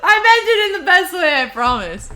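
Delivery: high, loud, still cracking up; laughing protest. Real, not acted. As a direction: insist through leftover laughter. Protesting right after laughing hard.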